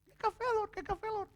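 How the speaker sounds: a high parrot call, now hushed; quiet parrot call. Retold or imitated. Preacher imitating the parrot calling softly when tame, high but hushed. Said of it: "ele ficou quietinho".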